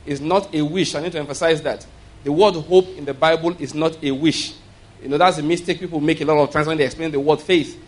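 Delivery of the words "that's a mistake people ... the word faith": ordinary talk, nothing marked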